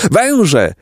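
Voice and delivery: high-pitched cry of alarm; despairing cry. A narrator's reading. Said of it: "krzyknęli z rozpaczą".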